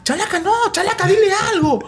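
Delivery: high-pitched urgent call to the co-commentator; excited on-air plea. Retold or imitated. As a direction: beg your partner for a word mid-broadcast. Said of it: "y yo digo".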